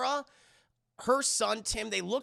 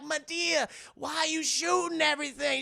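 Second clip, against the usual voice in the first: high-pitched mock-panicked protest; acted alarm; 9 semitones higher, 3 dB louder than usual. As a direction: plead in put-on alarm, pitched up. Acting a bystander alarmed by shooting.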